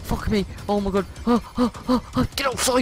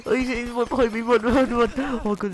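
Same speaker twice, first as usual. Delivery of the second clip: panicked yelling; 7 semitones higher than usual. Real, not acted. Fleeing the killer, yelling.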